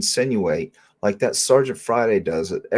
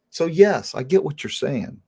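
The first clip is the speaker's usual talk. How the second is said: ordinary talk, nothing marked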